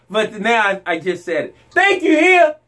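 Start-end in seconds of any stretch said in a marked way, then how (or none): 1.7-2.5 triumphant thanks. Real, not acted. I couldn't say that word.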